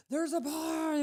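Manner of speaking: high, loud alarmed exclamation; alarmed cry. Retold or imitated. Brother-in-law alarmed at a smoking barn, high excited cry.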